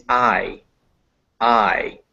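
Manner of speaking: "I" as ah gliding into a weak I; diphthong demonstration. Shown as a demonstration. Teacher showing what ah and I make, gliding "I". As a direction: slowly say "I" as two vowels joined. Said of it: "you have ah and I".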